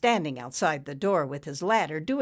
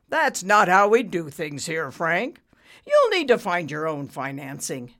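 lightly patronizing, louder voice; arrogant banker, patronizing; 6 dB louder than usual. A narrator's reading. Banker explaining to borrower, patronizing. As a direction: brush him off condescendingly. Ricky's character voice.

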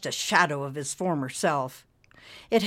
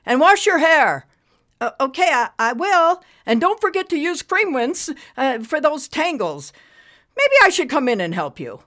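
loud, high mother calling through a door; a firm mom talking through the bathroom door; mother calls in to her daughter, firm; 10 semitones higher, 9 dB louder than usual. Acted. Mother keeps instructing.

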